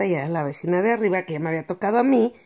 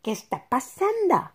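higher, startled mock question; surprised inner voice, raised pitch; 9 semitones higher than usual. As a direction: sound caught off guard. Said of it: "yo estaba sorprendida".

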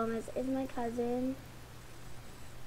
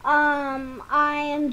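loud, drawn-out greeting back; excited return; 2 semitones higher, 9 dB louder, much slower than usual. Real, not acted. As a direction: announce it with a big stretch. Announcing return loudly.

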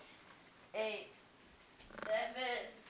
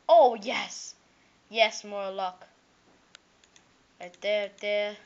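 ordinary talk, nothing marked

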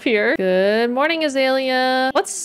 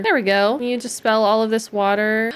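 ordinary delivery

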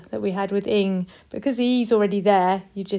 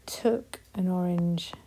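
whispered answer; 3 semitones lower, 6 dB quieter than usual. Shown as a demonstration.

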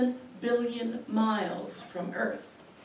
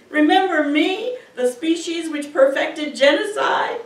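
loud, raised-pitch appeal; doomed species' plea; 4 semitones higher, 8 dB louder than usual. Acted. A dying species addresses a future species, loud plea. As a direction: call it out as a ringing question. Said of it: "said to a future species".

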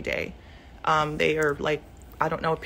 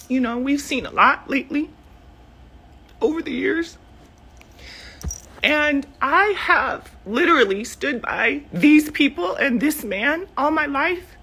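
emotional; 8 semitones higher, 4 dB louder than usual. Real, not acted. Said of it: "I just get so emotional".

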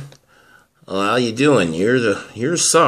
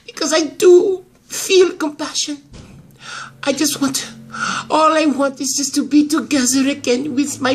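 higher, crying protest; tearful plea; 6 semitones higher than usual. Acted. Grandmother pleading to be reunited with her daughter, tearful. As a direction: plead through tears, pitched up.

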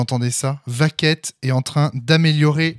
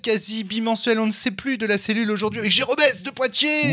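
podcast host intro; 7 semitones higher than usual. Acted.

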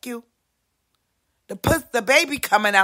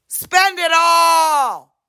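drawn out, louder, higher yell; slow shout; 5 semitones higher, 5 dB louder, much slower than usual. Acted. Urging everyone to blow all their money. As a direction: belt it out slowly like a command.

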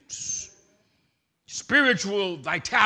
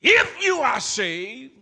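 preaching shout; 6 semitones higher, 9 dB louder than usual. Acted. Preacher at full force.

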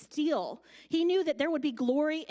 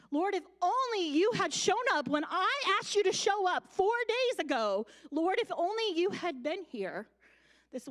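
anguished plea; 5 semitones higher than usual. Retold or imitated. Congregation's pleading prayer to God.

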